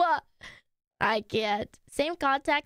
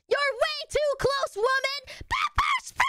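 mock shrieking woman; 11 semitones higher than usual. Quoting a TikTok audio, shrieking. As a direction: yell it like a woman fending someone off.

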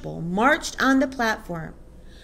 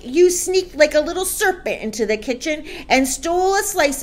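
loud, indignant, raised-pitch rant; shouted tirade; 5 semitones higher, 8 dB louder than usual. A narrator's reading. Headmistress accuses boy of stealing her cake, shouting. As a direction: rant the charges to a whole hall. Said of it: "the Trunchbull shouted".

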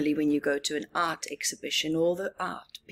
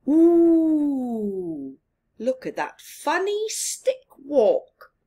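higher, louder hooting tease; teasing owl; 7 semitones higher, 6 dB louder, slower than usual. Acted. Owl laughing at Bob's stick walk, higher hooting tease. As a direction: coo out the mockery like a gossiping owl.

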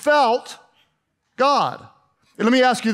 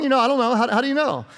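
ordinary talk

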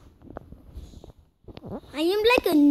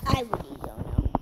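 whisper; 5 semitones lower, 4 dB quieter than usual. Real, not acted. Mutters defeated.

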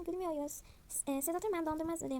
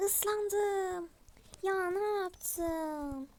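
higher, louder, drawn-out whine; upset whine; 5 semitones higher, 4 dB louder, much slower than usual. Pretend. Soaked doll whines slowly to her sister. Splashed with pool water.

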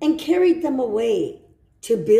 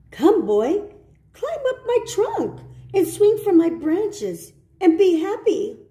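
a lightly voiced tree, higher; lightly higher, coaxing tree voice; 8 semitones higher than usual. The tree invites the boy to play.

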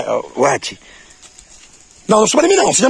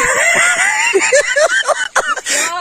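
high squealing burst of laughter. Real, not acted. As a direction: shriek with laughter. Burst after teasing.

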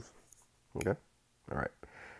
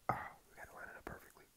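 quick, hushed whisper; concentrated whisper; 23 dB quieter, faster than usual. Real, not acted. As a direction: whisper to yourself while taking aim. Lining up the last shot.

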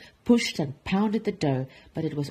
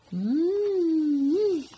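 long, high "mmm" of delight; savouring mmm; 10 semitones higher than usual. Acted. Duchess tasting her sweet roll, high savouring hum.

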